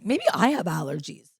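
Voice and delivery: worn out after a night out; sounding like the morning after; hungover. Real, not acted. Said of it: "I just sound like this 'cause I went out last night".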